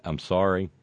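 ordinary talk

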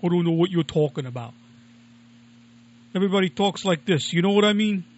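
hammed-up Brooklyn shrug; Brooklyn accent. Joking that everyone online sounds this way, mock Brooklyn accent. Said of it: "specifically a Brooklyn accent".

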